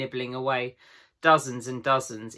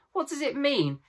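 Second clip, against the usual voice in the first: frightened; 12 semitones higher than usual. A narrator's reading. Susan frightened at broken table.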